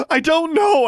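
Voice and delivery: very high, anguished shout. A narrator's reading. Girl driven to breaking by questioning, shouted. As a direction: yell it at the top of your voice. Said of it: "Alexandra shouted".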